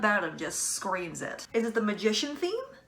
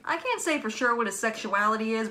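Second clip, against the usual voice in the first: ordinary delivery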